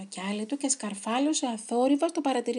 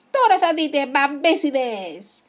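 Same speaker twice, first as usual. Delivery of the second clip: loud warning with a drawn-out insult; shouted threat; 3 semitones higher, 8 dB louder, slower than usual. A narrator's reading. Threatening the sneaky foes during the chase, shouting. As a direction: call out the threat, stretching the last word.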